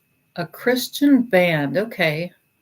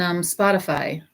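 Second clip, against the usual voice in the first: ordinary talk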